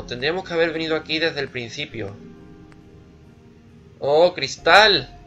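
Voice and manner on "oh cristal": slow, loud, delighted exclamation. Real, not acted. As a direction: savour the word with glee. Delighted at finding glass.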